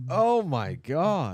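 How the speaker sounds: loud, high, drawn-out amazement; real astonishment. Real, not acted. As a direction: stretch it out, stunned by the story. Listener stunned by the fight story.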